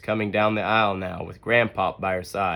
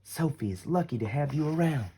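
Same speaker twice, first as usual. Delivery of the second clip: hushed, softer tender mother; 4 semitones higher, 6 dB quieter than usual. Acted. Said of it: "she whispers".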